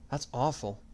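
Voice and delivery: soft, quiet sadness; sad. A narrator's reading. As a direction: say it sadly and softly. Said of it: "Yui said sadly".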